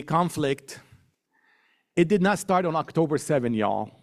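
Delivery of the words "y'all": a lower Oklahoma twang on "y'all"; Oklahoma accent. Shown as a demonstration. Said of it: "That's my Oklahoma accent".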